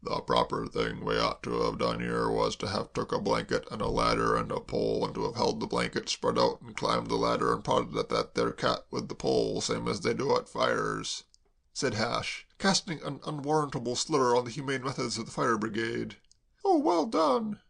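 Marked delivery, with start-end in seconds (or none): 0.0-11.2 gloomy manservant. A narrator's reading. Hash's low voice. 16.6-17.6 cried out. A narrator's reading. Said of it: "cried Kay".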